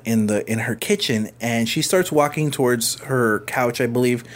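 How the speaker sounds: ordinary talk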